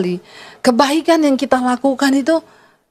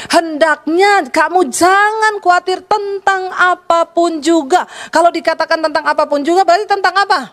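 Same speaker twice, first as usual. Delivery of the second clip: raised preacher's voice, reading scripture; preaching; 6 semitones higher than usual. Acted. Pastor preaching to the congregation about worry, raised voice. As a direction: proclaim it to the congregation.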